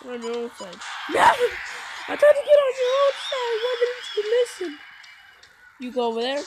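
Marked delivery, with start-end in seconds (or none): 1.1-4.8 whiny wail. Real, not acted. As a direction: wail it like you've been cheated. Kid losing at game, wailing.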